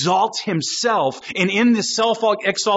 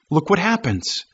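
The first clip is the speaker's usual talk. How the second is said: ordinary talk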